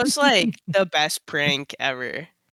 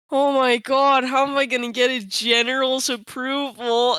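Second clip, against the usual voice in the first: panicked; 8 semitones higher than usual. Retold or imitated. Retelling panic over the email.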